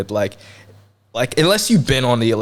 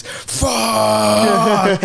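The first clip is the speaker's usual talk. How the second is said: sudden high yelled curse; angry shout; 11 semitones higher than usual. Retold or imitated. Friend realises cash is fake.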